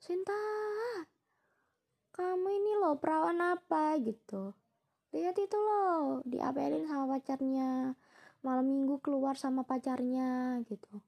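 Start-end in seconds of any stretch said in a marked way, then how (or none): 0.0-3.9 fussing grandmother. Retold or imitated. Grandmother teasing her granddaughter about having no boyfriend, fussy high imitation. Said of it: "yang nenek aku ini yang heboh gitu". 5.1-10.6 slow, drawn-out imitation of grandma's nagging; fussing grandmother. Grandmother comparing her granddaughter with a dating friend, imitated fussy nagging. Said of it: "yang nenek aku ini yang heboh gitu".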